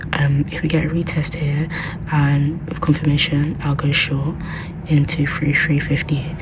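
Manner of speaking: hushed library whisper. Real, not acted. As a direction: whisper so the library can't hear. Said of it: "I have to whisper because obviously I'm in the library".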